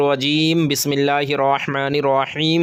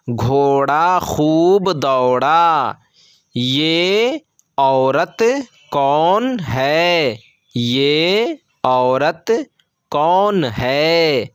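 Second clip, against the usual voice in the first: slow model reading; much slower than usual. Shown as a demonstration. Teacher to pupils, reading the practice sentences very slowly. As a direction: read each word very slowly and evenly.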